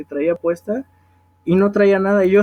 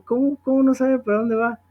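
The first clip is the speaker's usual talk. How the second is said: retold puzzled question, pitched up; baffled disbelief; 6 semitones higher than usual. Helper answering a lost old woman, mildly acted bafflement. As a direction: act out being taken aback, voice higher. Acting out his baffled reply.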